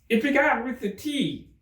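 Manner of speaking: high, anxious voice; nervous Hatter. Acted. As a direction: explain timidly.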